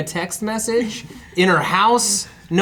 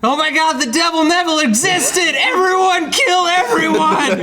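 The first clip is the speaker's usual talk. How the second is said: frantic excitement; 9 semitones higher than usual. Pretend. Mock frenzied crowd reaction.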